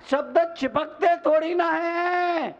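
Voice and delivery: high, declaimed reassurance to the crowd; emphatic repeat. Real, not acted. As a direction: proclaim it high with a long ending. (ऊँचे सुर में ज़ोर देकर)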